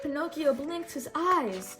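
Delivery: ordinary delivery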